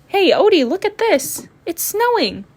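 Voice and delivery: high, loud, thrilled call to a friend; excited call. Acted. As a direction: shout it out like you just saw snow. Excited call about snow.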